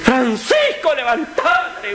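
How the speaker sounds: high, desperate call to wake him; frantic wife waking her husband; desperate cry. Acted. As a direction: shriek his name in panic. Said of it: "una desesperación en la voz".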